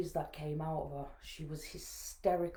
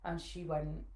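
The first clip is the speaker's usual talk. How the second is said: softer, subdued telling; a little emotional; 7 dB quieter than usual. Real, not acted. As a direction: hold back real feeling, keeping it quiet. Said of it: "excuse me if I get a little bit emotional".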